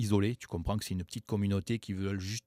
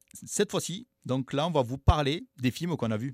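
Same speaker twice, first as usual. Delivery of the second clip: usual speaking voice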